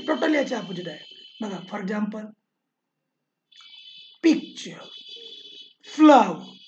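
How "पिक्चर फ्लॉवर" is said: Picture, flower with a loud first syllable; stress on first syllable. Shown as a demonstration. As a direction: hit the start of picture and flower. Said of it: "सुरुवातीचा syllable stressed आहे".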